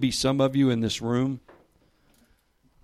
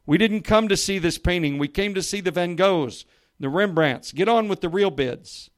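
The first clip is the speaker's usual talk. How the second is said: higher, louder, a little irritated; a hint of an angry collector demanding the real bids; mild angry shout; 8 semitones higher, 7 dB louder than usual. A narrator's reading. Collector at the auction complains to the auctioneer, mild angry shout. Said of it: "another voice shouted angrily".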